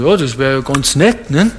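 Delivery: a hint of an indifferent churchgoer shrugging it off; mild mock of a lukewarm answer. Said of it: "Dann sagen sie".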